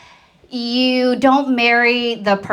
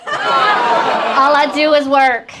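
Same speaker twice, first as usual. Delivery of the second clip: slow, louder, each word hit; stressed slower repeat; 2 semitones higher, 5 dB louder, much slower than usual. Shown as a demonstration. Comedian repeating her complaint, slow and louder. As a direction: drag it out for the punch.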